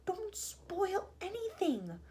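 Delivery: slow, high whisper; pleading whisper. A narrator's reading. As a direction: whisper slowly, urging them to behave. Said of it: "whispered to the two old women".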